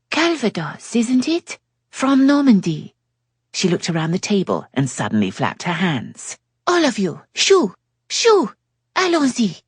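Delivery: high, rising to loud shoo; French lady shooing. A narrator's reading. Yasmin shooing the guests from the table, high and imperious. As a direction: flap them away in a high French voice.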